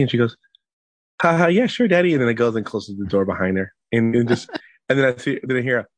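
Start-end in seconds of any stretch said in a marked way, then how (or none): 1.2-2.1 put-on high-pitched, blithely agreeable child; lightly voiced giggly kid, pitched up; imitated little daughter. Daughter agreeing to give daddy privacy, high child voice.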